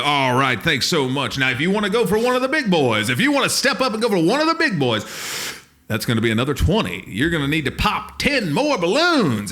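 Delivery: high, loud sales pitch; carnival barker. Retold or imitated. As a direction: upsell the big prize. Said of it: "We were literally carnival barkers".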